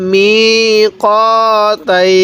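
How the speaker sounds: very slow, every syllable stretched; drawn-out reading. Shown as a demonstration. Teacher drawing out the word for students, very slowly. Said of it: "এরকমভাবে পড়ব".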